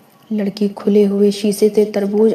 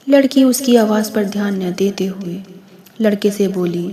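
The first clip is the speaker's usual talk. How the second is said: ordinary delivery, nothing marked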